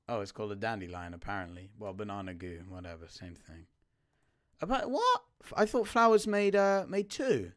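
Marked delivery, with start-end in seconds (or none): 4.6-7.5 high, loud baffled outcry; surprised. Real, not acted. Crafting gives one item, loud surprised protest. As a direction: protest the recipe in disbelief.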